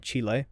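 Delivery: Chile said as "CHEE-lay"; deliberate mispronunciation. Shown as a demonstration. Host reading out a post, stubbornly saying Chile as CHEE-lay. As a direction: pronounce slang Chile in two syllables, "CHEE-lay". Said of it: "I will always pronounce that Chile".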